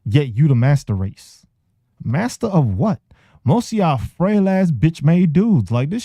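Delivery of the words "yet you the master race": normal talking voice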